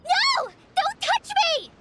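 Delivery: very high, frightened shriek; panicked cry. Acted. Collei recoiling from Paimon's approach, panicked. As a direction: cry out in sudden alarm, recoiling.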